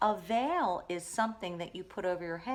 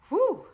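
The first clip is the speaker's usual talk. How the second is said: shrill-free squeaky whoosh; a big high toy whew; high whistle whew; 12 dB louder than usual. Acted. Toy sound effect, very high loud.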